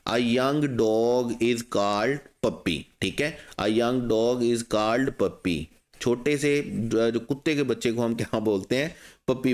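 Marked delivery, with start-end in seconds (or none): 0.1-2.8 very slow, word by word; teacher modelling a bridge statement; slow model sentence. Shown as a demonstration. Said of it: "आप bridge statement कैसे बनाएंगे?". 3.6-5.6 very slow, word by word; teacher modelling a bridge statement; slow model sentence. Shown as a demonstration. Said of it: "आप bridge statement कैसे बनाएंगे?".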